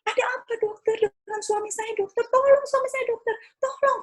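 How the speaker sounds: frantic wife screaming at the doctor to save her husband; retold screaming. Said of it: "Saya menjerit-jerit".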